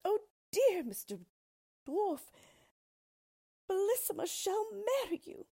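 high-pitched, loud plea in broken bursts; desperate loud cry. A narrator's reading. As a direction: cry out for rescue, acted full out. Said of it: "cried out as loud as she could".

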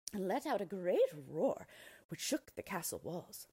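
ordinary delivery, nothing marked

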